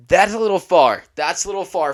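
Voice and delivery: loud, high outburst; exclaiming. Real, not acted. Vlogger reacting to a shocking episode, loud and high. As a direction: blurt it out in disbelief.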